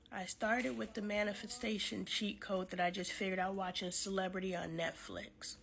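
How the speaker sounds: normal talking voice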